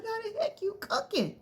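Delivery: high, squealing mock disbelief; incredulous daughter. Daughter told to stay for dinner, incredulous high squeal.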